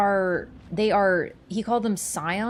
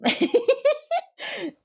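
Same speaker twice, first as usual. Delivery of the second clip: laughing. Real, not acted. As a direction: burst out laughing. Laughs at own trolling.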